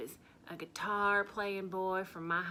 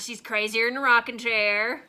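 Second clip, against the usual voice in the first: mocking the doubters; 3 semitones higher, 13 dB louder than usual. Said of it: "I can read your faces".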